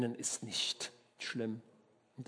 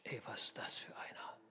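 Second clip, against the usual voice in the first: much softer, breathy whisper of disbelief; hushed shock among the bearers; astonished whisper; 3 semitones lower, 11 dB quieter than usual. Acted. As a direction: whisper it as a startled pallbearer.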